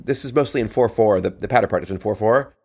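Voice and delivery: ordinary talk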